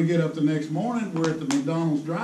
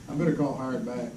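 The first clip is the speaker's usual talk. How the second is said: ordinary delivery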